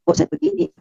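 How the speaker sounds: high, tearful cry of despair; pleading through tears; a hint of a sob in the voice. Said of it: "Saya menangis, saya bilang".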